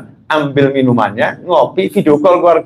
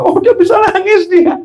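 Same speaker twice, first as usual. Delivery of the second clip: imitating parents in a very high voice; amused parent. Parents laughing at their crying child, high mock delight. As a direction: squeal it like a tickled parent.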